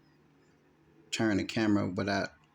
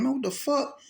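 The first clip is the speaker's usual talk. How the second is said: much higher, startled yelp of a question; alarmed cry; 14 semitones higher than usual. Retold or imitated. Kid running back into his house, alarmed and high. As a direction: shoot it up high, caught off guard.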